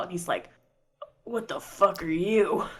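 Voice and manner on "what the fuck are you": slow, hushed disbelief; horrified elf. Acted. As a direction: ask it softly and slowly in horror. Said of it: "fucking horrified as well".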